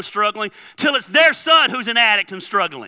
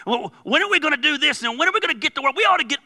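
higher-pitched, hurried mimicry of naggers; pushy mock questioning, pitched up and rushed; impatient pestering; 6 semitones higher, much faster than usual. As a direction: imitate people badgering you with questions. Imitates people pestering him.

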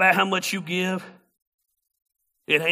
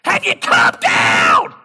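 lower, loud, slow shouted question; booming preacher; 8 semitones lower, 13 dB louder, slower than usual. Retold or imitated. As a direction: boom it down at the kid from the pulpit. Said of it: "He said".

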